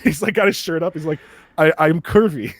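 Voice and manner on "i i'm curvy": put-on light thick Japanese accent. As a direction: imitate a Japanese guy, lightly. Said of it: "a thick Japanese accent".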